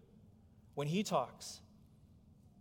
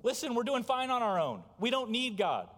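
raised and louder, brushing God off; smug scoffer; 8 semitones higher, 7 dB louder than usual. Retold or imitated. Preacher voicing scoffers who reject God, smug and loud. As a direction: scoff like you need no one. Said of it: "Scoffers are saying".

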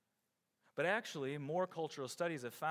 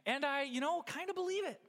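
high, wishy-washy "kind of believe it"; half-hearted belief; 11 semitones higher than usual. Retold or imitated. Imitating a lukewarm Paul to the church, wishy-washy. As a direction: shrug it off, unsure and noncommittal. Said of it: "simply saying".

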